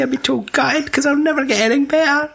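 squeaky high mock wife voice. Friends mocking the wife, squeaky high mock voice. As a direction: pitch it way up to mock the missus.

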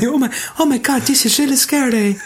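high-pitched fake fright; hammed-up timid squeal of a line; mock scared. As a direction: imitate a frightened character in a high voice. Mimicking a frightened film character.

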